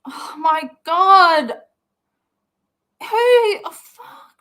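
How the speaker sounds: loud, drawn-out, rising high exclamation; real astonishment. Real, not acted. Viewer reacting to a video, loud drawn-out astonishment. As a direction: stretch it out slowly, loud and high.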